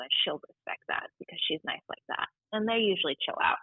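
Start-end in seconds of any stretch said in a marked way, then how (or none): 0.0-2.3 soft whispered reassurance; comforting a frightened kid in a whisper. Retold or imitated. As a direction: whisper it to calm a child. Reassuring scared kids aside.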